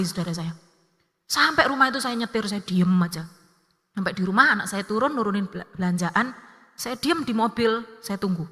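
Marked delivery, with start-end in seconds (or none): none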